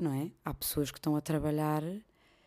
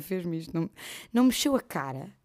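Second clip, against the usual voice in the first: usual speaking voice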